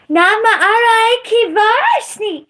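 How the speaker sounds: high, loud gibberish chant with a laugh; mock speaking in tongues. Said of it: "the tongues that I emphasized".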